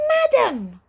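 a butler wailing for madam from downstairs. Acted. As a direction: wail it from downstairs. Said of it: "wailed Flabbergast from downstairs".

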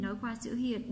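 ordinary talk, nothing marked

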